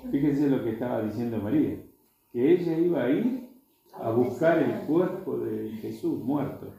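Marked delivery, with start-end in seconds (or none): none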